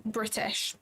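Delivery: a touch of a posh British accent; lightly put-on British accent. Shown as a demonstration. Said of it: "My accent is".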